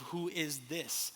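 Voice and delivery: "Who is this?" drawn out slowly, each word apart; slow weighty question. Real, not acted. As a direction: let "Who is this?" hang, slow and soft. Posing the key question.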